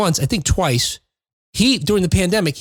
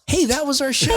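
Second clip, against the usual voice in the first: higher, bright smiling sign-off; 9 semitones higher than usual. Shown as a demonstration. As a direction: say it with a big grin. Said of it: "Hey, I'm gonna smile".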